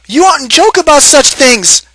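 high, loud reproach; scolding. A narrator's reading. Wife scolds husband for joking, high and loud. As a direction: call him out sharply. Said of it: "she scolded".

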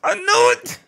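high, loud hype scream; excited shout. Real, not acted. Reactor celebrating a guest from Maryland, excited shout. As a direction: whoop it out, thrilled.

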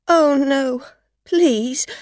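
high, loud, alarmed begging; desperate pleading. A narrator's reading. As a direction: beg in a panic. Afraid driver begs: no police.

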